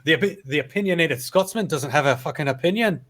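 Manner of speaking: put-on a botched Scottish accent that drifts Irish; Scottish accent, lightly done and off; Scottish accent attempt. To friends, a failed Scottish accent that sounds Irish. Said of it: "That sounded more Irish".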